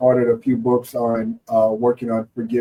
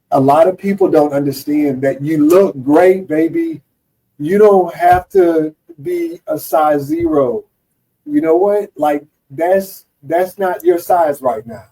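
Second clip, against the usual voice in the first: reassuring; 5 semitones higher, 3 dB louder than usual. Pretend. Coaching a patient on body image.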